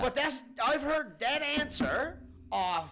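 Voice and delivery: loud, high, fed-up complaint; exasperated rant. Real, not acted. Complaining he's heard that answer before, exasperated rant. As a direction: hammer it out slowly at full volume.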